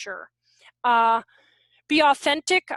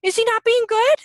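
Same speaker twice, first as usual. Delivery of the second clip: higher, louder surprised question; son's surprise; 8 semitones higher, 6 dB louder than usual. Retold or imitated. Son asking about the dog, higher and louder. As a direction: ask it like a puzzled kid. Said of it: "my son's like".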